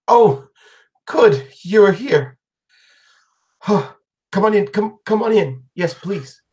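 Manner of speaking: lady's voice, pitched well up; fussy, welcoming old lady; high old woman voice. Acted.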